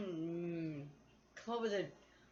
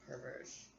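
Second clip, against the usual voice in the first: breathy aside, trailing off; whispered tease; 7 semitones lower, 14 dB quieter than usual. Real, not acted. Teasing viewers about their dirty thoughts, whispered.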